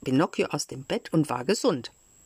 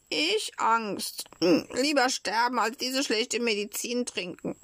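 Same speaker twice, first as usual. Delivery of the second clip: put-on higher-pitched stubborn puppet child; defiant scoffing; 6 semitones higher than usual. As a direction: scoff back in a high child's voice. Puppet scoffs at fear of dying. (trotzig und mit hoher Stimme)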